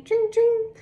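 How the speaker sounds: high, ringing ding ding; bright high bell chime. Pretend. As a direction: sing it out like a bicycle bell. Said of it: "A bicycle bell ringing".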